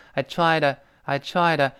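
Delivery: "I tried" with the d swallowed into "to"; unreleased d. Shown as a demonstration. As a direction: say "I tried" without popping the d. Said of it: "那个的失去爆破了".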